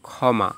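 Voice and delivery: ক্ষমা as "khawma"; kh sound. Shown as a demonstration. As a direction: say ক্ষমা with a single initial kh. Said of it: "তাহলে উচ্চারণ হবে খ".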